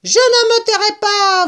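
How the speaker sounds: very high, loud rat squeal; vociferating rat. A narrator's reading. Rat refusing to be silenced, high vociferating. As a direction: squeal in shrill defiance. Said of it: "vociférait le rat".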